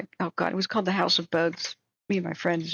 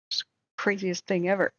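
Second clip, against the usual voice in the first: ordinary talk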